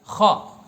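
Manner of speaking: loud guttural "خا" from the throat; correct pronunciation. Shown as a demonstration. Preacher answering his own quiz, loudly. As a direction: punch out a loud rasping خا. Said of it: "لیکن یہ ہے لفظ کیا؟".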